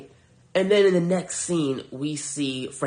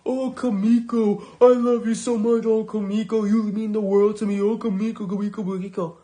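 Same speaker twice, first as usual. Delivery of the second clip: higher, louder lovesick parody; mocking lovesick Frenchie; 8 semitones higher, 4 dB louder than usual. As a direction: ham up a simpering lovestruck romantic. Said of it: "in his little French stupid accent".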